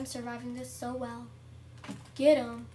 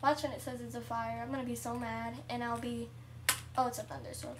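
ordinary talk, nothing marked

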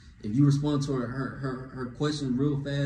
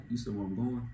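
soft, casual echoed question; cool asking back; 7 dB quieter than usual. Acted. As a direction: ask it back quietly, unbothered. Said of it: "be like".

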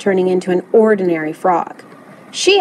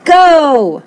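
loud, higher drawn-out starting shout; roaring; 6 semitones higher, 6 dB louder than usual. A narrator's reading. Coach launches the race, roaring. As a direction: roar it out like a coach. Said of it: "roared the coach".

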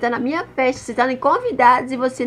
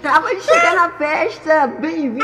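raised-voice arrival call; loud party announcement; 3 semitones higher than usual. Acted. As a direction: announce the birthday girl to the room. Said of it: "Ai, fala baixo".

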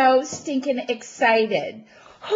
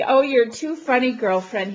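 normal talking voice

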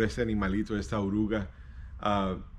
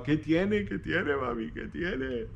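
put-on worried child; 8 semitones higher, 4 dB quieter, slower than usual. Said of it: "bien preocupado".

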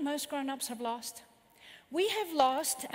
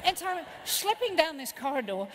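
usual speaking voice

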